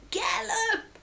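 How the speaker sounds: high-pitched storybook shout; dad's high, urgent call; urgent cry. A narrator's reading. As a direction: cry it out high to spur them on. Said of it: "cried Dad".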